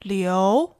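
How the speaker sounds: Liu rising upward; second tone. Shown as a demonstration. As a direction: let Liu climb. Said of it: "Here are the four tones".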